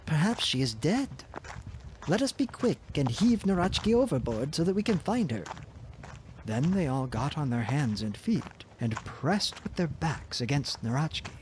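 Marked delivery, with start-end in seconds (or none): 0.1-5.4 higher, lightly voiced urgent brother. A narrator's reading. Brother urges them to heave the ogre, lightly voiced urgency. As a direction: hurry the others on. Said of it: "said Triltril".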